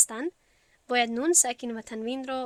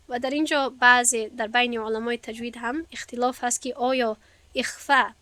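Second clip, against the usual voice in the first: ordinary talk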